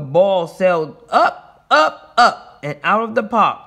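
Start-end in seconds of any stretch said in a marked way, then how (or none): 1.1-2.6 higher, louder rising calls; punchy "up, up"; excited narration. A narrator's reading. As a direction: follow the ball up with your voice. Reading aloud, ball sailing up.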